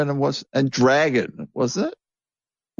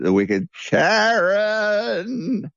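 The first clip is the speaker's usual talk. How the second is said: mock dramatic; 9 semitones higher, much slower than usual. Mocking [name] while playing [name] on the phone, hammed-up and slow. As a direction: stretch it slowly like a panto villain's name.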